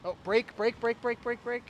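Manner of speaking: rising, urgent repeated brake calls; urgent shout. Real, not acted. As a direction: call it out fast to stop her.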